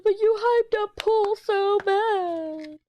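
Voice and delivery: high, loud, drawn-out mock wail; hammed-up squeaky kid complaint; whiny kid imitation. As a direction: whine it slowly like a sulking child. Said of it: "he was always a whiny kid".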